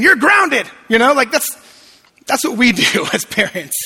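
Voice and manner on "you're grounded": mock parent.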